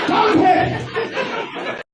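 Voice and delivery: very high-pitched ogre call; hammed-up ogre caricature; Shrek-like caricature. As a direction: summon them in a silly, high Shrek voice. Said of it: "And I turn her into Shrek".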